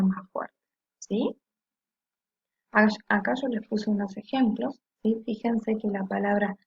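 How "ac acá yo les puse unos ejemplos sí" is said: ordinary talk, nothing marked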